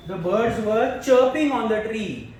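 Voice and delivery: very slow, chirping held out; stress on chirping. Shown as a demonstration. Teacher to class, leaning on chirping in a slow reading.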